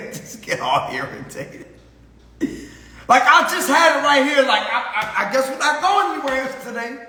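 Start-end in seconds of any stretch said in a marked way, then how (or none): 3.1-7.0 high, frazzled imitation of a flustered woman; hammed-up flustered whining, pitched high; mock fretting. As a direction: play up the irritated searcher. Imitating person who lost wallet.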